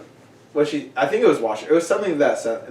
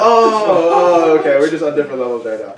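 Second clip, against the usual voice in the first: loud laughing, fast realization; 4 semitones higher, 9 dB louder than usual. Retold or imitated. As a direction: laugh as it finally clicks.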